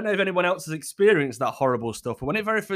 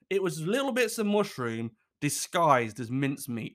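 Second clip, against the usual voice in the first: usual speaking voice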